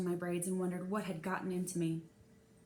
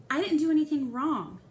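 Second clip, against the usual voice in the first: put-on higher, louder, anxious boy pleading innocence; boy's worried protest; 6 semitones higher, 9 dB louder than usual. A narrator's reading. Jeffrey frets to the narrator on the way to the office, higher and louder. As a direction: fret that you did nothing wrong. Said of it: "Jeffrey said".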